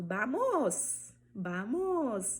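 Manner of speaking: VAMOS said "bamos", lips closing. Shown as a demonstration. Teacher modelling Spanish V, "bamos". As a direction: start vamos with a B sound. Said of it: "o som que vai equivaler ao B".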